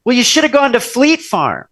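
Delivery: high-pitched mock mom scolding; reproachful mother. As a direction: imitate a mother finding fault. Mother retold criticizing the shopping.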